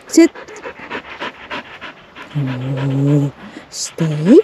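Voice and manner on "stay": ordinary delivery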